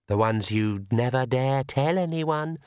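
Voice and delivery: very slow, eerie accusing voice; shadow voice. Acted. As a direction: drag out the accusation from the dark. Shadow voice taunts.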